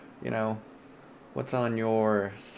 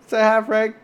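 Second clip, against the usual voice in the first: loud prompt; 12 semitones higher, 9 dB louder than usual. Real, not acted. Calling on cohost to greet.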